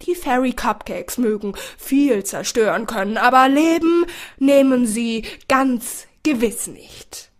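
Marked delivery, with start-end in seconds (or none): none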